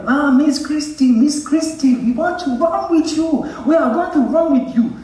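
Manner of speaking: put-on high-pitched, excited little children clamouring; excited kids. Voicing kids calling to Christy.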